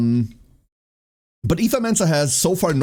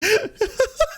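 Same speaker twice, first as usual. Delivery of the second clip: high giggle burst. Real, not acted. Still laughing.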